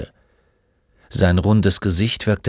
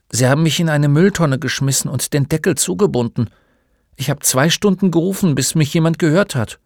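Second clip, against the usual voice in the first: quick, higher-pitched confession; lightly voiced aggrieved boy; bullied schoolboy; 7 semitones higher, faster than usual. Boy recounts the bin prank to the old man, higher and quicker.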